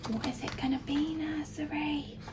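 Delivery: whisper. Real, not acted. Reader whispers suspense to child.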